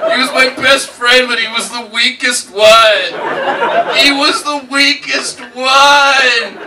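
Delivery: high, loud desperate rant, half-laughing; unhinged narrator lament. Retold or imitated. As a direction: wail it like a broken man confessing. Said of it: "He's like".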